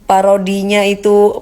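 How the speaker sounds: ordinary delivery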